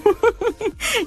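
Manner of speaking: high bubbly giggle; light giggle; real laugh. Real, not acted. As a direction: laugh off the compliment. Laughs denying she sings.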